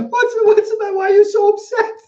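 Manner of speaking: high, loud imitation breaking into laughter; laughing, high-pitched mock concern; baffled congregants. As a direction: mimic worried congregants while cracking up. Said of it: "looking at me thinking".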